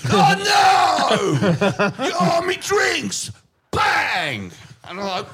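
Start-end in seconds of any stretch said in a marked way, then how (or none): 0.1-3.3 high laughing shout, slowing on the last words; cackling yell of mock outrage; laughing outcry. Mate's drinks spilled, laughing outcry. 3.7-4.5 mock bang. Acting out the seat slam.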